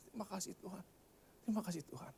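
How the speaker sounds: soft, tearful retold thanks. Preacher recalls thanking God after being moved, softly tearful. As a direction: murmur it softly as if close to tears. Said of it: "Saya langsung nangis".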